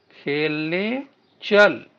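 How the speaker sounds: very slow, stretching each word; slow demo reading. Shown as a demonstration. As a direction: read it slowly so the children can copy. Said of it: "आगे बोलिए बच्चों". (शब्द खींचकर धीरे पढ़ना)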